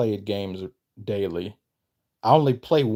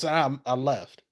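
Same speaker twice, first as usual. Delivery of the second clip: ordinary talk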